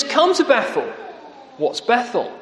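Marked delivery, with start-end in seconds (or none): 0.0-0.9 high, sarcastic call to come; mock the people with a lilting invite; mocking invitation. Preacher voicing Amos mocking worshippers, sarcastic. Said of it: "Amos now starts to mock".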